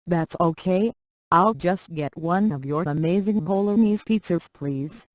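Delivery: usual speaking voice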